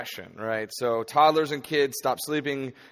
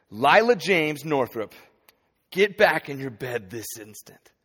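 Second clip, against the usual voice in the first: firm, slow commanding father; stern dad voice; 2 semitones higher, 6 dB louder than usual. Retold or imitated. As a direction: order the child back to bed. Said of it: "in my dad voice".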